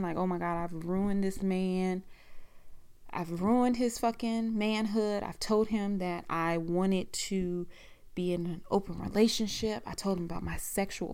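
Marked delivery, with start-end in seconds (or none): none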